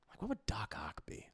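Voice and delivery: whispering. Real, not acted. Joking aside during chat.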